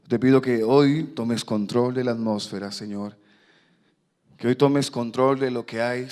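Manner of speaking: loud, earnest pulpit prayer; fervent prayer. Real, not acted. Preacher praying to God before the sermon, fervent. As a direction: pray aloud with force, easing at phrase ends.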